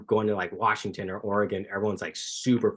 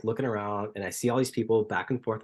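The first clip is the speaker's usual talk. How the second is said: usual speaking voice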